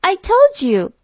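put-on high little-boy protest; Tommy reminding his mother, childlike; insistent child Tommy. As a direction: chirp it like an impatient boy. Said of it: "answered Tommy".

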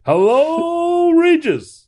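high, drawn-out heckler's call; yelling down at crowd. Acted. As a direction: holler down to a crowd from above. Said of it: "show up to yell down at the crowd below".

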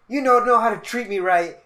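loud, high imitation of an outburst; retold yelling. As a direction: mimic a student yelling at his teacher. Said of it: "I just hear yelling".

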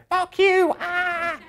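mock baby tantrum shriek at the adult; screaming baby. Baby demanding milk, acted.

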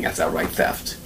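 hushed, breathy aside; low whispered emphasis. Shown as a demonstration. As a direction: whisper it like a secret. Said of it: "aside, unvoiced".

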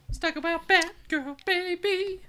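goofy high-pitched showman voice; high silly voice. Pretend. Playful sing-song announcement.

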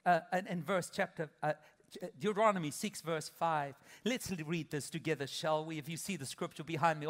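ordinary talk, nothing marked

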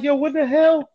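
can't believe what he's wearing; teasing a new guy about his boots, incredulous. Retold or imitated. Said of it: "I'm like".

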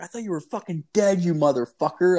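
high, fast half-angry outburst; cursing him out after the scare; relieved angry yell. Retold or imitated. Friend yelling at roommate who scared him.

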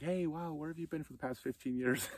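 put-on high, loud, delighted surprise at an old friend's call; surprised delight. Rival surprised to hear from him.

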